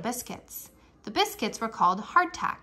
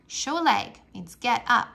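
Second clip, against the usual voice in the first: plain reading voice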